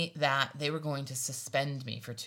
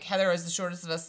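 usual speaking voice

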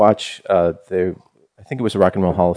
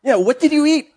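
high, silly put-on voice; mock character voice; 13 semitones higher than usual. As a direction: squeak it out like a cartoon. Voicing the dead author mockingly.

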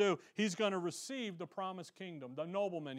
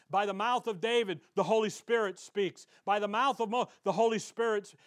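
ordinary talk, nothing marked

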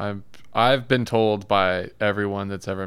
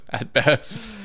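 higher pitch, laughing through the words; real laughing; 8 semitones higher than usual. Real, not acted. Co-host amused by the offend-people remark, laughing. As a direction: repeat it while cracking up.

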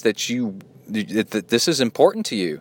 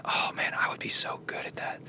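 whisper; 9 dB quieter than usual. Real, not acted. Aside while reading listener email.

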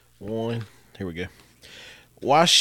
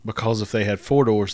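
ordinary talk

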